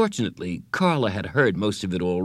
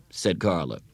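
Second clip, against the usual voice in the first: plain reading voice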